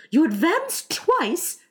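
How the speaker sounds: high, drawn-out eager call; excited instructions. Acted. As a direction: call the dance step high and slowly. Mock Turtle excitedly explains dance steps.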